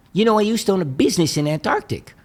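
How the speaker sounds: imitating an old Eastern European man's accent. Caller from Pasadena to Mike, in a mock Eastern European accent. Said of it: "very heavy Eastern European accent".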